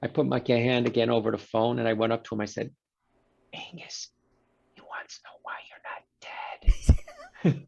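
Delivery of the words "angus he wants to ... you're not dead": hushed conspiratorial whisper. Retold or imitated. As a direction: whisper it behind your hand. Secret aside to patient about doctor.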